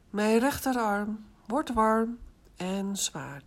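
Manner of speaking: slow, even autogenic formula. Shown as a demonstration. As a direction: say it slowly, for them to repeat. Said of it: "Herhaal twee keer in gedachten". (langzame formule)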